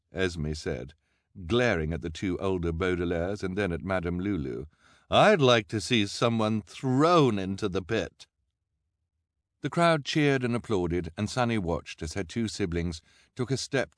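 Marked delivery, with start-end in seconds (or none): 5.1-8.3 loud, slow, drawn-out demand; menacing villainess. A narrator's reading. Esme glaring at her rivals, loud slow villainess voice. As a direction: draw it out loudly and pointedly.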